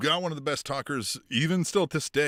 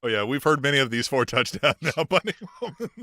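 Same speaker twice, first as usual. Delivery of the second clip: laughing; 2 semitones higher, 4 dB louder than usual. Real, not acted. Teasing laugh at repeated story.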